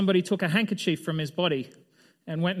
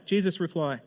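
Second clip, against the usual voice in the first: usual speaking voice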